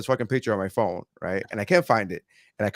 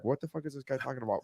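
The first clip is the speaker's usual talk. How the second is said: mock confused mutter; 15 dB quieter, faster than usual. Quoting confused commenters.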